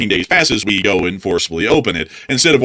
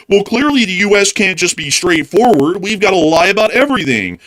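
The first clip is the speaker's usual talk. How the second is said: mock know-it-all; 8 semitones higher than usual. Mocking people who defend lying in foreign policy, higher smug voice. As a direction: lecture it with mock smugness. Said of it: "some people will sit there and say".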